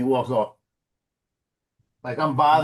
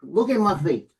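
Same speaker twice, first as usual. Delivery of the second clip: normal talking voice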